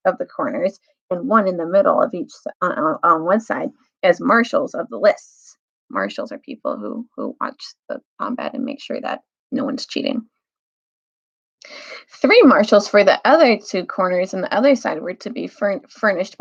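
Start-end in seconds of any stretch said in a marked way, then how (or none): 5.9-10.3 quiet aside. Real, not acted. Reader's aside explaining word.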